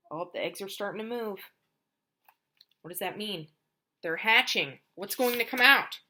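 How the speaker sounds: plain reading voice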